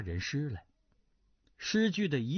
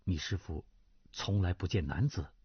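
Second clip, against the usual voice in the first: lower, subdued voice of a middle-aged lord; older nobleman, gentle; 7 semitones lower than usual. Acted. Duan Zhengchun voice. (低沉温和的中年男子)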